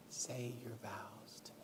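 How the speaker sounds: a last, barely audible plea; fainter whisper. Retold or imitated. Dying wife repeats her plea, fainter whisper. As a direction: whisper fading almost to nothing. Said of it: "she was whispering to me".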